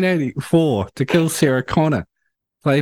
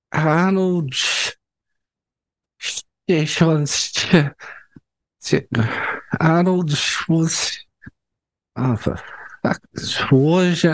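stumbling over name; 3 semitones higher, much slower than usual. Real, not acted. Stuck on a hard name.

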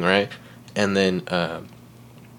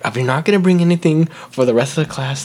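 teacher's reprimand; 6 semitones higher than usual. Retold or imitated. Retold teacher reprimanding the child.

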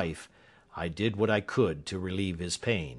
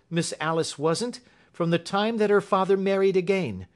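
high-pitched servant woman's tale; Mrs Toller, earnest; 8 semitones higher than usual. Acted. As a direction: give your testimony, pitched high. Voices Mrs Toller in story.

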